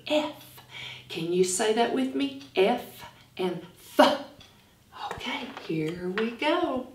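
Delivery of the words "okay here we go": whisper. Acted. Starting story, hushed.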